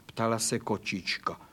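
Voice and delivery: plain reading voice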